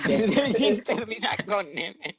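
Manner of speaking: high, drawn-out words broken by laughing. Real, not acted. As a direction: repeat it through helpless laughter. Repite la observación muerto de risa. (entre carcajadas)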